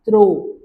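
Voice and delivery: throw, p kept silent; French trop. Shown as a demonstration. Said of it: "it will be".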